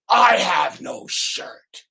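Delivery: slow punchline declaration. Acted. Beggar man tells the king his secret, slow and emphatic. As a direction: stretch each word for comic weight. Beggar man's big reveal.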